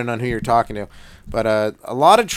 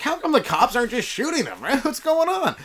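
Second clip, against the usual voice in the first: baffled disbelief; 10 semitones higher than usual. Retold or imitated. Acting out his baffled reaction.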